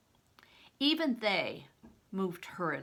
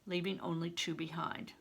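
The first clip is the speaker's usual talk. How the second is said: usual speaking voice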